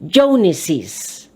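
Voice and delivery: "Joneses" read with an extra syllable after Jones; "Joneses" as two beats, JONE-siz. Shown as a demonstration. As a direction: sound the added -iz ending in "Joneses". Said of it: "this becomes an extra syllable".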